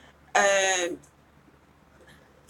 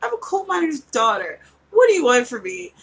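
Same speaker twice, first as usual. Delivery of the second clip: sassy country retort; 4 semitones higher, 7 dB louder than usual. Retold or imitated. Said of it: "I'm like".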